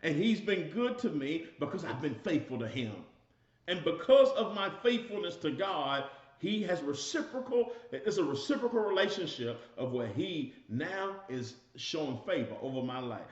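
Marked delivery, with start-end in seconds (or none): 3.7-9.6 preaching. Acted. Preaching climax.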